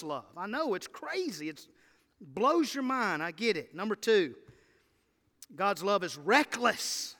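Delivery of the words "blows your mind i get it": ordinary talk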